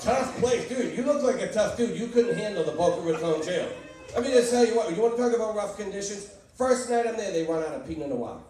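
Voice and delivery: ordinary delivery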